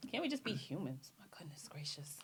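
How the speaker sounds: hushed, whispered exasperation; soft, voiceless murmur of disbelief; whispered plea. Real, not acted. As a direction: whisper it as a weary aside. Exasperated aside under the others' talk.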